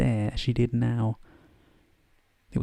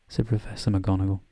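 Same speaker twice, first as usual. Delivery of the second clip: ordinary delivery, nothing marked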